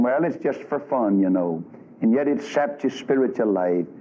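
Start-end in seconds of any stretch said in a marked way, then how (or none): 0.0-1.6 mocking wicked preacher. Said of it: "some old wicked preacher somewhere saying".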